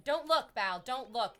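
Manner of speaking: normal talking voice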